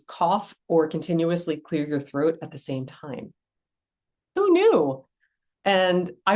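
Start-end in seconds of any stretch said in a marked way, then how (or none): 4.3-5.0 "Who knew?" jumps high and bright with amazement; surprised. Real, not acted. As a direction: sound amazed, as if you just found out. Surprised by the life hack.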